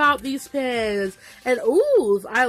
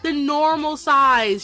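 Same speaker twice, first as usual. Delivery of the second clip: excited; 7 semitones higher, 5 dB louder than usual. Retold or imitated. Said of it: "I'm like".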